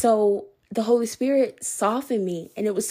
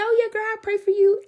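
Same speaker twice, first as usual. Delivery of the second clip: high, airy brush-off; mock cheerful girlfriend promise; breezy friend voice; 13 semitones higher, 4 dB louder than usual. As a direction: toss it off like an empty promise. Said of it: "they say".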